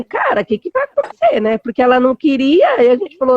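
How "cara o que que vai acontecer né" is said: espanto preocupado. Retold or imitated. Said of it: "a gente falou assim".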